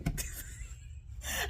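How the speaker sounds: silent wheezing laugh; breathless laugh. Real, not acted. Woman catching herself after outburst, wheezing laugh. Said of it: "I had a moment".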